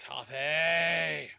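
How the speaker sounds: excited shout. Real, not acted. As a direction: cheer for the find. Spots coffee item.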